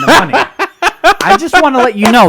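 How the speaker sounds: loud, high laughing outburst. Real, not acted. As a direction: burst out laughing mid-line.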